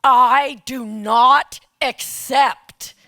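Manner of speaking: slow, loud, every word spaced; slow and loud, with full resolve; firm refusal. Retold or imitated. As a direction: refuse the nomination deliberately, word by word. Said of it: "And I said".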